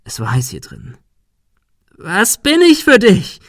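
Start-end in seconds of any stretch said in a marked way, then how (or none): 2.0-3.4 high, loud, slow shrieking question. A narrator's reading. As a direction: screech it at him. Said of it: "Sarah kreischte". (kreischend, hoch und laut)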